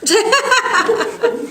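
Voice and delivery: high amused laugh; laughing at the rookie's answer. Real, not acted. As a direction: burst out laughing. Laughing at own story.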